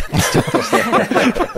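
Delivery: laugh. Real, not acted. Laughing at Cantina Band image.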